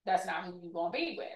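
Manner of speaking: quick, low-key refusal; dismissive enslaver. Retold or imitated. Enslaver forbidding a young woman's love match, dismissive. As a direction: lay down the rule quickly and quietly.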